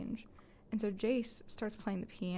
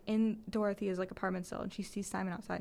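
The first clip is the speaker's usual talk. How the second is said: usual speaking voice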